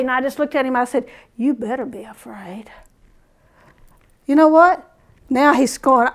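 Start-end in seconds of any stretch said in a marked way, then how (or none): 1.4-2.7 low, soft threat; retold quiet warning. Shopper warning the bread guy, low and quiet. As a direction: drop low and soft to warn him off.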